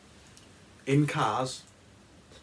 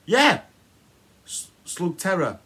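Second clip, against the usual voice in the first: normal talking voice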